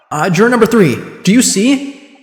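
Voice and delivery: usual speaking voice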